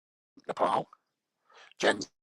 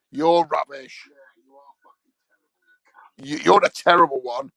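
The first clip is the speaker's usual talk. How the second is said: mock insult; 4 semitones higher, 10 dB louder, slower than usual. Trading insults with own recording.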